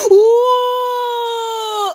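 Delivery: high drawn-out shout; long excited cry. Real, not acted. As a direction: hold a thrilled cry. (길게 신나게 외치기)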